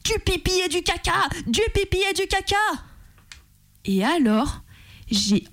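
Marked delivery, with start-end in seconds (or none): 0.0-2.5 recreate the outburst at the play at a high pitch without real volume; retold yell. Woman yelling into a microphone at the theatre, high mock yell. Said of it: "j'ai hurlé".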